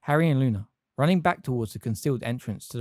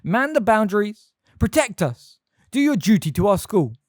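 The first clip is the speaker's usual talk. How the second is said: loud rallying shout; rally the troops loudly; 9 semitones higher, 7 dB louder than usual. A narrator's reading. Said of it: "shouted Professor McGonagall".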